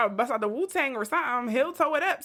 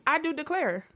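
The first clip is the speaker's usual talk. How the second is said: southern belle phrase; 5 semitones higher than usual. Pretend. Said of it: "running gag of the phrase".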